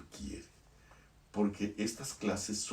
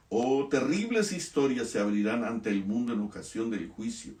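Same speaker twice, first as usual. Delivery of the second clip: fervent preaching; 2 semitones higher, 4 dB louder than usual. Acted. Preacher builds warning of judgment.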